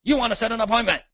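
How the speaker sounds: quick, high mock mentor voice; a real estate mentor lecturing a rookie, fast and high; mentor's advice. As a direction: rattle it off like an old coach giving a rule. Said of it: "and they said".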